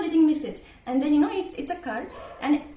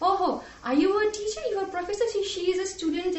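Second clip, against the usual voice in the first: higher-pitched imitation of mocking passengers; 7 semitones higher, faster than usual. Passengers make fun of her being a teacher, imitated mocking. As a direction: retell their jeering, pitched up in mock disbelief. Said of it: "started making fun of my occupation".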